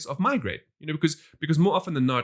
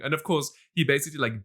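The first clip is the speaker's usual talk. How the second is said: ordinary talk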